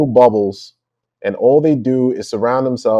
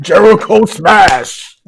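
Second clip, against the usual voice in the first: slow, loud, higher mock Hulk roar; hypocrisy Hulk; 9 semitones higher, 7 dB louder, much slower than usual. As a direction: smash it out like a cartoon brute. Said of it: "Justin is like the hypocrisy Hulk".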